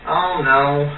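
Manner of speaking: usual speaking voice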